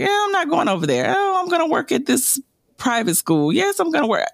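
quick and airy, like it was an easy choice; breezy retold decision. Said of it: "I was like".

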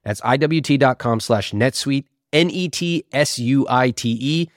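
upbeat sponsor read. Acted. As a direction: read it like a podcast ad.

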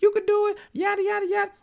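very high, squeaky cartoon voice; put-on tiny high voice, making an offer; Miss Minutes. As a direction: climb into a mock high-pitched character voice. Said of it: "Remember, she was like".

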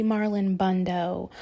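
plain reading voice

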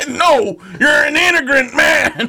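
high, loud laughing mock yell; mock shouting. Mock argument with a friend, laughing shout. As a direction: yell the insult while cracking up.

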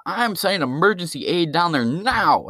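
sing-song parody of an official promise; Biden. Retold or imitated. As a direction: imitate a politician announcing aid. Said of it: "Because Biden was like".

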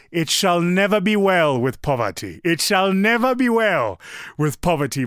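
bold proclamation. Retold or imitated. Kwame answering Moses among supercars, loud proclamation. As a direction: proclaim it like a blessing over the table. Kwame's exuberant reply at breakfast.

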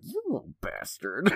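a quiet, slow exasperated grumble; muttered aside. Retold or imitated. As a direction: mutter it under your breath at the kid. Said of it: "And I'm just like".

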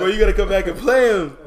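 laughing through the quote. Retold or imitated. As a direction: repeat it while laughing. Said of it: "He was like".

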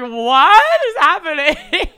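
slow, loud laughing disbelief. Retold or imitated. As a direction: drag it out through a laugh. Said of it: "And it's like".

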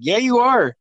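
high, insistent comeback, imitating a kid; mock-indignant kid contradiction; child's teasing retort.

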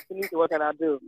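soft, unruffled, resigned reply; nonchalant husband. Retold or imitated. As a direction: play the husband calmly brushing it off. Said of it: "he's just so nonchalant about".

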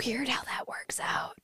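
hushed, knowing aside; mock-secretive whisper. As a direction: whisper it like a shared suspicion.

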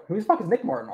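ordinary talk

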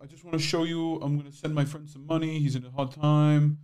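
high, soft showy influencer voice; mock influencer voice. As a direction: fake charity post voice. Mocking charity Instagram poster.

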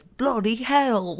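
high, drawn-out mock British exclamation; terrible put-on British accent; bad British accent. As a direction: imitate a hammy British accent, stretching the words. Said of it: "in a British accent".

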